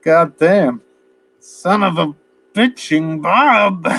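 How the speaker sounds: high, slow, drawn-out curse; hammed-up exasperated swearing; mock furious cursing. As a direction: imitate a man cursing his tormentor. Acting Leo cursing after mud spray.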